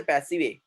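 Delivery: "passive A" run together as "passi-vay"; mispronounced "passive A", ending in "ay"; wrong final E. Shown as a demonstration. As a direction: voice the silent E of passive, "passive A" as one word. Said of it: "We don't say".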